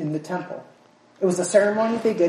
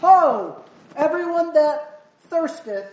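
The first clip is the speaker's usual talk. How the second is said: high, loud calling for attention; 7 semitones higher, 4 dB louder, slower than usual. Acted. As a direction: summon the crowd to listen. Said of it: "That means listen up, shouting".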